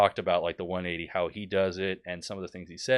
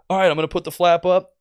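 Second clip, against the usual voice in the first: raised, louder presenter narrating his action; mock instructor announcing each step aloud; video tutorial host; 8 semitones higher, 9 dB louder than usual. Video pilot narrating flap changes to viewers, presenter voice. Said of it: "and he was like".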